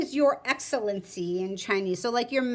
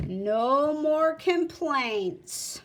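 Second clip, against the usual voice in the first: drawn-out agreement; much slower than usual. Acted. Shopkeeper repeats the question.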